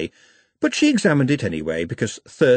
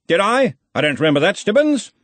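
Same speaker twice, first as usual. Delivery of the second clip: loud, breezy high archchancellor; booming offhand wizard boss; Ridcully's voice; 11 semitones higher, 4 dB louder than usual. A narrator's reading. Ridcully speaking.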